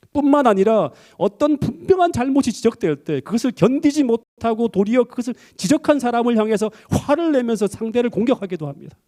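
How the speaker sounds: high, ringing sermon climax; fervent preacher; preaching at full force. Acted. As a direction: drive the point home from the pulpit.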